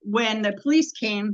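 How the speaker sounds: usual speaking voice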